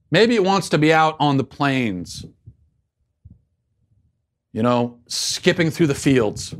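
dreamy then indignant put-on voice; a lightly voiced earnest activist; mock activist. Host continuing his parody of an activist, mildly mocking.

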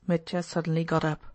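ordinary delivery

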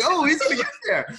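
high-pitched re-enacted thrill; retold fan excitement. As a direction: relive the runner breaking away, voice way up high. Re-enacts watching the breakaway run.

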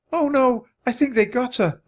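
high-pitched wail of distress; high, grief-stricken storybook cry. A narrator's reading. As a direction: wail in dismay. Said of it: "Annie wailed".

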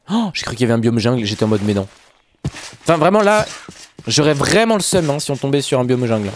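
ordinary delivery

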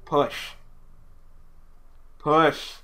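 insistent, rising push command; urging push. Acted. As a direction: push them on like a coach. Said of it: "You sound like a fucking midwife".

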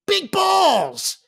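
imitating Bill Raftery's punchline. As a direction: blurt it like Raftery. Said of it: "He goes".

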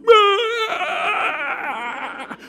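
put-on high, loud panicked child's cry; frantic little kid squeal; panicked yelp. Child panicking over zipper.

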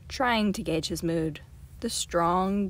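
usual speaking voice

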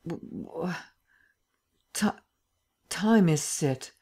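groggy, broken, slow stammer. A narrator's reading. Drugged Lupin tries to ask the time, stammering. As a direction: struggle out of a stupor, sounds catching. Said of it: "he stammered".

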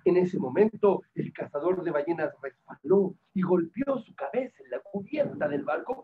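usual speaking voice

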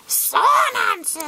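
high-pitched drawn-out squawk; high, put-on creature call; creature name cry. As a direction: shout the name like a cartoon creature. Calls out card name in character.

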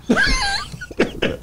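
shrieking high laugh; high cackle. Real, not acted. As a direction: burst into a squealing laugh. Punchline of his comedy bit.

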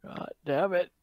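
usual speaking voice

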